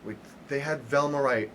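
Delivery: normal talking voice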